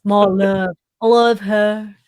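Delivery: corny romantic voice. Retold or imitated. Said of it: "That is so corny".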